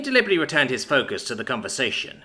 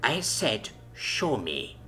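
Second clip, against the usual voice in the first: careful menace; 6 dB quieter, much slower than usual. Acted. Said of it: "she repeated her words carefully".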